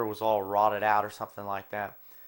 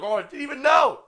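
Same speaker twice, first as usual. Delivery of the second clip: much higher, loud, wide-eyed innocence; mock innocent Ronnie; 8 dB louder than usual. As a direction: play dumb in a high, loud voice. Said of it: "just like".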